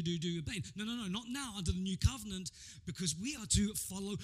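ordinary talk, nothing marked

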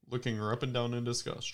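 ordinary talk, nothing marked

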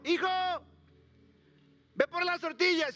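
high, loud call across the house; mother calling out. Retold or imitated. Mother calling her son to fetch tortillas, loud high call. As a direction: call the son like a busy mom.

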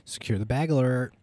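hyped, loud alert call; loud hype callout. Pretend. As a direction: shout it like an alarm announcement. Hyped joke announcement.

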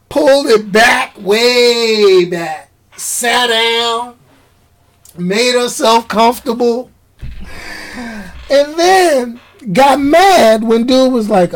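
usual speaking voice

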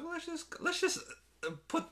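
very high, hesitant silly voice; mock falsetto. Park staff deciding what to do, mock falsetto. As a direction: squeak it like a dithering official.

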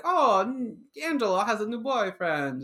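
a hint of a tittering neighbour spotting news; gossiping old couple. Imitating the old gossips as the family passes, a light gossipy voice. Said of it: "they go like".

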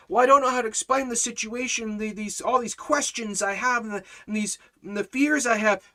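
high, loud, stumbling fretfulness; worked-up follower. Acted. Follower pouring out questions and fears, high and anxious. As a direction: pile up the worries, flustered. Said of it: "We get all worked up".

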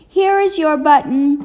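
high, loud happy call; excited cry. A narrator's reading. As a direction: call it out like you found treasure. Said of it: "cried Frog".

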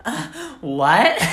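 high, loud, laughing "uh, what?"; obnoxious fake confusion; most obnoxious voice. Shown as a demonstration. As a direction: sound as annoyingly clueless as possible. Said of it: "in, like, the most obnoxious voice you can".

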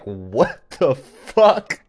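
shocked disbelief. Retold or imitated. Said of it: "She was like".

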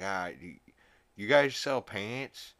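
a hammered guy asking for pants at a drive-thru; drunk Peter. Retold or imitated. Drunk Peter orders at window.

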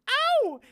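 very high, loud "Ow!" yelp; imitated singer's yelp. Imitating the song from the fish video, high yelp. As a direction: belt a sudden pop-star squeal. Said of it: "was him going".

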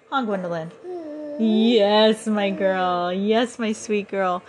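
drawn-out, lilting sweet talk; real motherly coo, slowing down. Real, not acted. Soothing her baby.

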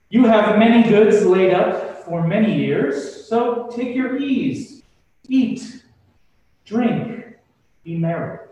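rich man toasting himself. Acted. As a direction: spread the words out grandly, celebrating. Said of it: "he goes on to say".

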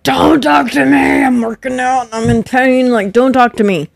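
hammed-up irritation while in pain; a hammed-up snap at someone chatting; annoyed mock snap. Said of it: "I'm like".

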